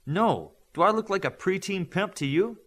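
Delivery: high, put-out denial; indignant. A narrator's reading. Midas tells Olympian he is no pimp, indignant. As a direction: scoff at the very idea.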